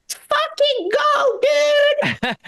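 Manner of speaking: very high, slow, cocky squeal; reckless little dog. Retold or imitated. Greta voiced charging the Rottweiler.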